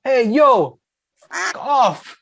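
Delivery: high, loud call-out to the cars; mock yell. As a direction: holler it high at drivers in the way. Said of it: "and he goes".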